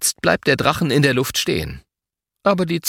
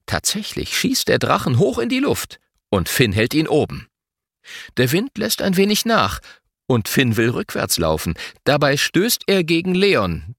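plain reading voice

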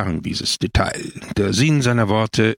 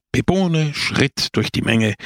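ordinary delivery, nothing marked

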